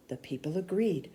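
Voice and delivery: ordinary delivery, nothing marked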